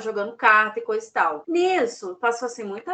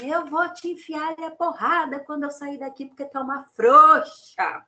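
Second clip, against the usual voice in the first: mock threat; 6 semitones higher, 6 dB louder than usual. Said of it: "Aí ela falava assim".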